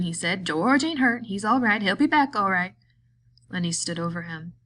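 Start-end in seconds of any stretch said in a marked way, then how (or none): 0.5-2.7 higher, louder, nervous backpedal; hasty reassurance. A narrator's reading. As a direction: placate him fast. Crooks backpedaling from danger.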